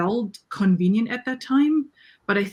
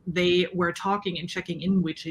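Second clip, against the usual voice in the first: usual speaking voice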